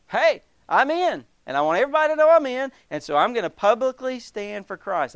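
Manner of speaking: high, loud and rushing with excitement; bubbling over with the news he's saved; eager convert. Acted. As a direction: burst out like a thrilled new believer. Voicing an eager new believer.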